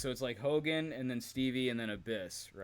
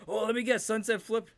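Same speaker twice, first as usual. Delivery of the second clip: much higher, louder mocking sneer; sarcastic agent; 11 semitones higher, 8 dB louder than usual. Imitating agent Tony Garea mocking a match finish, sarcastic. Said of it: "He does a Tony Garea".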